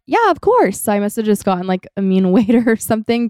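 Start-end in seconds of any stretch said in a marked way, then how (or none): 0.1-0.8 very high, obliging staff voice; imitated eager agreement. Restaurant staff answering a friend's request for other food, imitated eager agreement. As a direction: agree readily, pitched right up.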